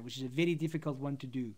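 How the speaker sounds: South African accent with a tapped R in "very"; conversational South African English. Shown as a demonstration. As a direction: say "very" like "viddy", South African style. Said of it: "South African accent".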